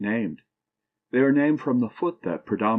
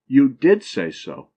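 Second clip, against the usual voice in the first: "did" punched louder and higher in a slow phrase; emphasis on DID; 3 semitones higher, 6 dB louder, slower than usual. Shown as a demonstration. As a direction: slowly, hit DID hard in "You did say so". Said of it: "stress of voice laid upon particular words".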